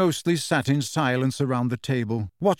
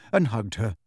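ordinary delivery, nothing marked

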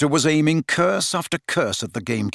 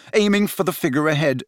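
ordinary delivery

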